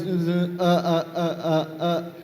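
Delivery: soft, halting string of uhs; quietly sputter, unable to answer; dumbstruck stammer. Retold or imitated. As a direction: at a loss for words. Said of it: "All I probably said was".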